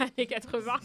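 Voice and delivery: laughing through the words at her own slip; amused laugh mid-sentence. Real, not acted. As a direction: giggle it out, high and bright with laughter.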